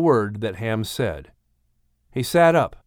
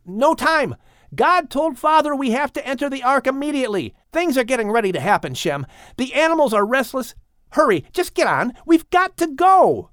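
much higher, pressing and rushed; urgent; 11 semitones higher than usual. Acted. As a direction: push him to hurry. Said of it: "Ham said urgently".

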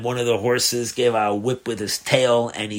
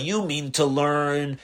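plain reading voice

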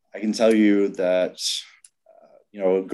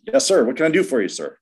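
eager reply; 7 semitones higher, 5 dB louder, faster than usual. Retold or imitated. Buddy eagerly answering the officer.

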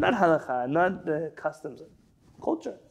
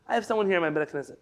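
normal talking voice

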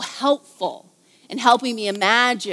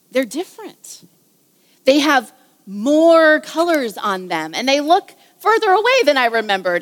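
wistful wonder; 3 semitones higher, 3 dB louder, slower than usual. Acted. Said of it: "said Lord Diggory softly".

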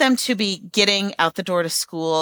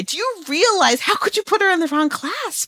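higher, indignant mock confrontation; blowing up at the director; 10 semitones higher than usual. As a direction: imitate an outraged parent demanding answers. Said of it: "me blowing up at a teacher".

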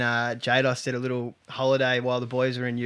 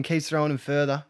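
ordinary talk

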